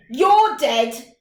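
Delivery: loud, gloating triumph; loud, smug victorious call; with satisfaction. Acted. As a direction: relish catching him. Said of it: "he said with satisfaction".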